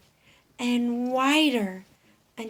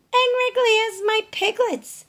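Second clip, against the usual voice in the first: pig voice; 13 semitones higher, 10 dB louder than usual. Acted. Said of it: "oinked Penny Pig".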